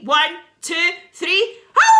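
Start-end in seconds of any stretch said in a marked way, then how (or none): 1.1-1.9 very high, loud howled count; werewolf howl. Pretend. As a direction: howl it out wild. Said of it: "all the pupils on the count of three".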